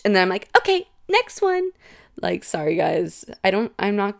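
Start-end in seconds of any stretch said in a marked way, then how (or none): 0.6-1.7 very high mock-breezy switch; put-on high, perky moving-on voice; abrupt cheery transition. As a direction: parody your own clumsy segue. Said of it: "my transitions are so bad".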